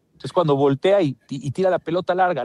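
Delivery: ordinary delivery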